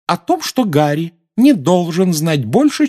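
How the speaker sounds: high, loud, slow insistence; emphatic reproach. A narrator's reading. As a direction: spell it out slowly and forcefully. Mrs Weasley's emphatic quarrelling answer. (с нажимом, медленно и высоко)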